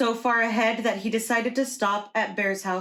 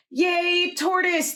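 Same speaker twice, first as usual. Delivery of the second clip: crowd cheering; 9 semitones higher, slower than usual. Acted. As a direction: cheer the winner on. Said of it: "the crowd roared".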